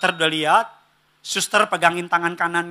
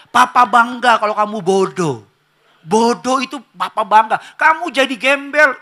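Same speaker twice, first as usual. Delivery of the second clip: loud, raised, hammed-up proud dad; mock fatherly pride; 4 semitones higher, 8 dB louder than usual. Absurd father praising his child's failure, loudly mock-proud. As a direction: declare absurd pride loudly, building higher.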